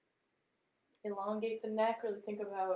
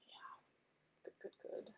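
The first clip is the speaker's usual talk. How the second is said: soft unvoiced whisper; 18 dB quieter than usual. Real, not acted. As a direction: breathe the praise quietly. Encouraging the class.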